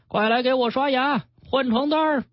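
high-pitched demanding call, little extra volume; hollering. A narrator's reading. Rowdy patient demanding a nurse brush his teeth, high-pitched hollering. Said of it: "一边扯着嗓子喊".

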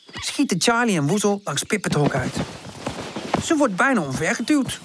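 ordinary delivery, nothing marked

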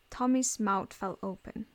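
plain reading voice

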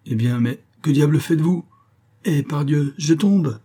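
high, loud startled exchange; alarmed exclamation. A narrator's reading. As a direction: exclaim as the horse gives way. Dialogue alarmé, cheval qui tombe. (exclamations alarmées, voix haute)

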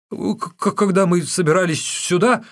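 high, loud, stuttering answer; panicked captive stuttering out a reply; terrified stammer. A narrator's reading. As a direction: trip over the first word in fright.

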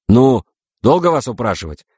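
high, exasperated prodding; impatient. Acted. Navigator prods the reluctant captains, impatient. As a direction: hurry them along with irritation.

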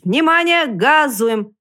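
loud call to the troop; loud rallying order; shouted command. A narrator's reading. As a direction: shout it out like the leader of a line. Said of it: "Первый кричит".

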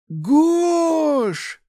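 very high, loud long call; drawn-out high shout of a name; joyful scream. A narrator's reading. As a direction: scream the name across the square. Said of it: "Крик совсем рядом".

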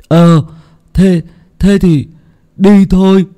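put-on high, loud boyish voice; eager boy. Hưng eager to set off, high boyish voice. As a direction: hurry off now.

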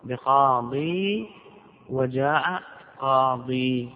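slow, deliberate reading of مررت بقاضي and جاء قاضي; slow example. Shown as a demonstration. Grammar teacher to students, giving slow examples. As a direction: spell out each word of the example slowly. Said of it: "تقول".

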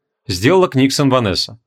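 usual speaking voice

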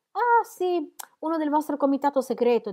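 high, loud teasing jab; provocative. A narrator's reading. Mrs Cadwallader needles Sir James about his acquaintance, provocative. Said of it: "in tono provocante".

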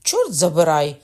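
loud angry oath through gritted teeth; angry curse through teeth. A narrator's reading. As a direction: snarl in pain and rage. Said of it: "крикнув він, заскриготавши зубами". (гнівний вигук крізь зуби)

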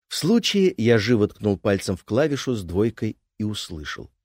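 plain reading voice

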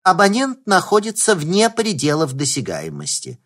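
lightly voiced automated phone message; phone menu voice. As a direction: read it like a recorded operator. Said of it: "электронный голос автоответчика".

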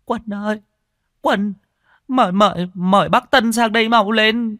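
high, tearful cry calling her son, loud by the end; whimpering plea. Acted. Old woman weeping, begging her son to fetch Tân, whimpering. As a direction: sob out the son's name and beg him to fetch help. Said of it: "mếu máo".

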